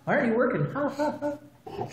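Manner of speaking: high, hollow put-on laugh around the words; imitating an awkward forced laugh; forced fake laugh. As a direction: mimic a strained polite chuckle. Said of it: "she's trying to laugh".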